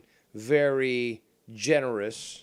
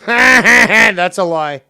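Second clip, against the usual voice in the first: laughing scoff; 10 semitones higher, 18 dB louder, much slower than usual. Real, not acted. Laughing mockery in an argument.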